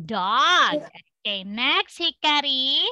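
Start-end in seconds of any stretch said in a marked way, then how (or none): none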